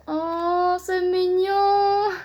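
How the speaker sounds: slow, high, sing-song coo; drawn-out high cooing delight; gushing. Real, not acted. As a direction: coo it like something adorable. Gushing over the manga.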